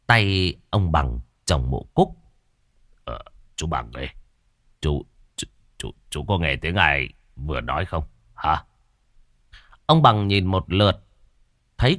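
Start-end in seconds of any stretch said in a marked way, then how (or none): 3.0-8.6 low, hushed, hesitant stammer; uneasy stammer. Acted. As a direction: ask furtively, tripping on the first words. Startled man asks brother, stammering. (lắp bắp dè dặt, giọng thấp và khẽ)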